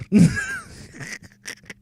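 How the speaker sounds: shrieking giggle way up high; high squealing laugh. Real, not acted. Gamer laughing at his own bad English, squeaky shriek. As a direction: burst into a squeaky laugh at yourself.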